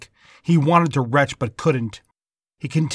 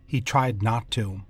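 usual speaking voice

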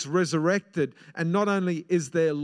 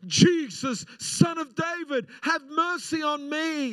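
crying out; 10 semitones higher than usual. Acted. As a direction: cry out even harder for mercy. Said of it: "He cried out all the more".

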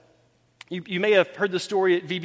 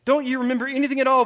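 brisk, higher-pitched acted reproach; quick exasperation; 5 semitones higher, 3 dB louder, faster than usual. Jesus reminding disciples what just happened, quick exasperation. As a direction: rattle the question off impatiently. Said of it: "goes back to what's just happened".

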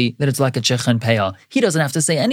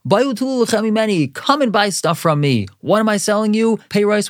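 higher-pitched mock hawker cry; vendor yelling; 7 semitones higher than usual. Stallkeeper calling shoppers to his produce, mock yell. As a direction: sell it to the passing crowd like a stallkeeper. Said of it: "he's yelling out and saying".

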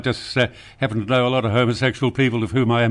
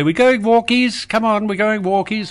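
woman's pet-talk voice; 9 semitones higher than usual. Acted. Host mimics women talking to pets, high coaxing voice. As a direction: mimic a woman calling walkies, pitched right up. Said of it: "What about when women say".

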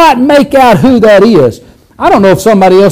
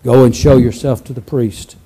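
much lower, soft and solemn; 11 semitones lower, 6 dB quieter than usual. Shown as a demonstration. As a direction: say it like a hushed, solemn Jesus. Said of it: "Jesus always just spoke solemnly and softly".

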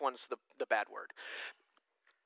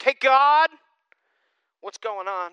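breezy mock greeting; 7 semitones higher, 8 dB louder, slower than usual. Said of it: "we're like".

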